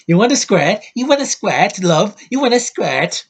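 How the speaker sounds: high, loud, exaggerated character voice; over-the-top mocking imitation; hammed-up cartoonish impression. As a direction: ham it up like a cartoon, swooping high. Impression of actor's cartoonish line.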